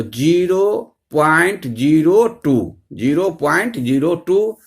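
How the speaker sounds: slow, spaced-out model reading; पढ़कर दिखाना. Shown as a demonstration. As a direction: read each digit out slowly for learners. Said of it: "इसको कैसे पढ़ेंगे". (धीरे-धीरे एक-एक अंक पढ़कर)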